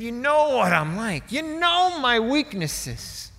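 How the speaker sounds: booming preacher's lament, loud and high; E V Hill. Retold or imitated. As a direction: plead with God at full voice. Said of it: "He was booming".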